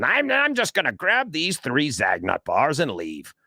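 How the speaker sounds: mock shoplifter thought. Imagined shoplifter's impulse thought.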